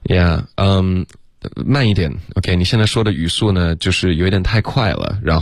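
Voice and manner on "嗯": ordinary talk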